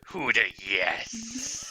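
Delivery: low, slow creature-like reply; companion's answering voice. Acted. Game master answers the player as Huda, slow role voice. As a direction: answer slowly in a put-on companion voice. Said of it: "You just hear in response".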